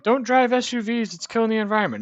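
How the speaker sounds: high, loud mock scolding of SUV drivers; mocking preachy crowd. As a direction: ham up the finger-wagging eco nag. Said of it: "everybody was like".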